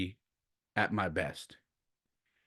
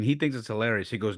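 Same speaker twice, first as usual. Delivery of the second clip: usual speaking voice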